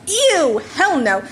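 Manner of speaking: high, loud disgusted "ew"; disgusted denial. Acted. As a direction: recoil in horror at the idea.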